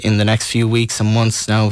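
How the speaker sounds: ordinary talk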